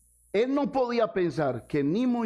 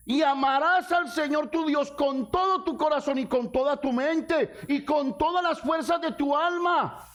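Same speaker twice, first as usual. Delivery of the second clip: fervent preaching; 10 semitones higher than usual. Acted. Pastor quoting Jesus' demands, fervent preaching. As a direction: proclaim the verse with force.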